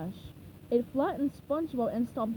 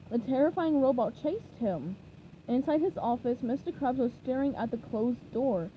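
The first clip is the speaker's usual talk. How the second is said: plain reading voice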